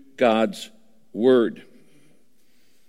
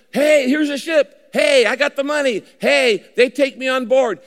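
a high, loud, excited call; hammed-up eager Jonah; Jonah's eager voice; 9 semitones higher, 5 dB louder than usual. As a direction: voice Jonah eagerly hailing the ship. Jonah voiced in retold story.

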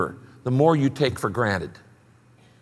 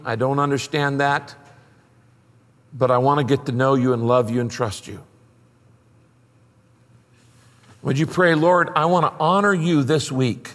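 led prayer; 3 semitones lower than usual. Acted. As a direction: pray it sincerely for the listeners to repeat. Leads congregation in prayer.

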